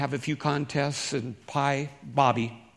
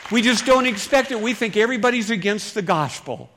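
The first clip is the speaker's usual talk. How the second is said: louder, higher, driving the point home; emphatic preaching; 8 semitones higher, 7 dB louder than usual. Real, not acted. Preacher stressing to the church that nobody expects it, loud and high. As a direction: preach it out to the congregation.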